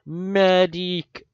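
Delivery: loud, high bellowed call for the medic; bellowing cry. A narrator's reading. Reader bellows the Heavy's cry for the medic, loud. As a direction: bellow it across the battlefield. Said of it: "a deep, bellowing cry was heard".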